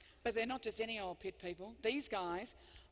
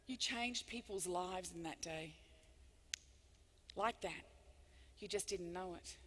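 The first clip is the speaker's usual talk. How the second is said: tender; 5 semitones lower, 7 dB quieter than usual. Pretend. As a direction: speak gently to the driver. Imagined words to the driver.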